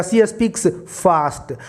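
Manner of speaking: each word drawn out very slowly; slow dictation. Shown as a demonstration. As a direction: read the example sentence very slowly for students. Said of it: "ये word आ गया". (बहुत धीरे, शब्द खींचकर पढ़ा गया)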